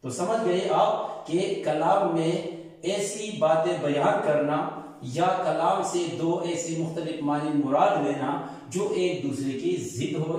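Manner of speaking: ordinary delivery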